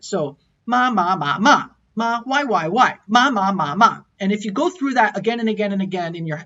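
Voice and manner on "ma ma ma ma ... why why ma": ma ma ma ma with four distinct tones; Mandarin four-tone drill. Shown as a demonstration. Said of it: "the four tones of Mandarin".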